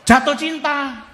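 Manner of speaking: high, loud, slowed cry of joy; joyful shout to the church; ecstatic. Real, not acted. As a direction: shout it like falling in love. Ecstatic punchline to congregation.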